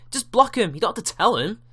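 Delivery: exasperated rant. Real, not acted. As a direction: rant about it in disbelief. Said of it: "It's incredible".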